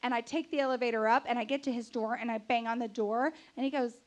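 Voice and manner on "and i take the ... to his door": ordinary delivery, nothing marked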